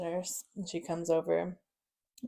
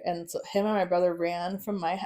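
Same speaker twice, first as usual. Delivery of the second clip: usual speaking voice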